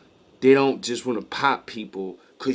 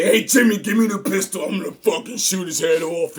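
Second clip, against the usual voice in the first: mock hothead outburst; 7 semitones higher than usual. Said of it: "He doesn't say".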